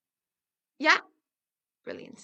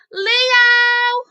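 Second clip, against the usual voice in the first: louder, higher call for a shy friend; shouting a name; 11 semitones higher, 16 dB louder than usual. Acted. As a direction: really yell his name out. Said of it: "we'll have to shout him even louder".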